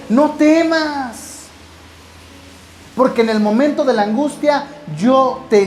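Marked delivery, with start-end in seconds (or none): 0.1-1.4 no temas, stretched extremely slow and high; slow reassurance. Acted. The Lord tells the believer not to fear, drawn out. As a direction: let each word hang, gently.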